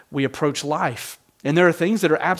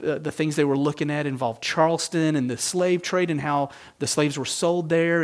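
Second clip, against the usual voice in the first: ordinary talk, nothing marked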